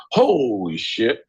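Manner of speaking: ordinary delivery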